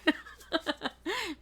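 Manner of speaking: high-pitched burst of giggles; laugh. Real, not acted. Reader tickled by own accent, high laughing. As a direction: crack up at your own bit.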